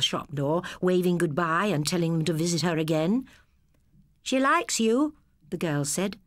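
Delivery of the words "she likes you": put-on girl's teasing voice. Girl teases brother.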